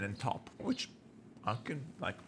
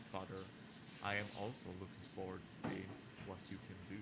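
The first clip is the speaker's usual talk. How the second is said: quiet dialogue reading; 15 dB quieter, faster than usual. Acted. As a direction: murmur the characters' lines under the breath. Reads game dialogue quietly.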